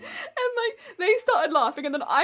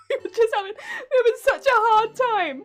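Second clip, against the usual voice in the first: laughing; 7 semitones higher, 5 dB louder than usual. Real, not acted. Laughing while retelling.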